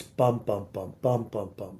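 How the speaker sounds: "Bump, bump, bump" in threes, first BUMP of each hit hardest; dactylic rhythm. Shown as a demonstration. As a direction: beat out "Bump, bump, bump" like a dactyl, strong-weak-weak.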